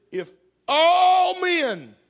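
long, slow, high cry of all men; rising shout. Acted. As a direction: stretch it out, a desperate vow. Peter's vow, acted.

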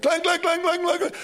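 high, loud ringing clangs; clanging imitation. Teller mimicking pipes clanging like alarm bells.

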